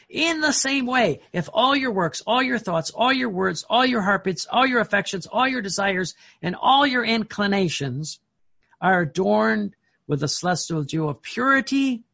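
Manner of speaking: high, insistent reading that builds a list; fervent devotional reading. Acted. Preacher reading Jesus's words on purity, fervently. Reads scripture-like passage with fervour.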